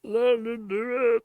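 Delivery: slow, high frog puppet voice; Kermit the Frog voice. Acted. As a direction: drag it out like Kermit. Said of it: "well, Kermit the Frog voice".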